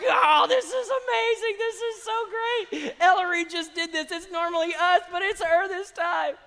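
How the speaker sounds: gleeful siblings loving the trouble; gleeful kids. Retold or imitated. Kids delighting that their sister got caught, giddy. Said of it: "they were like".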